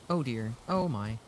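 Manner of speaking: usual speaking voice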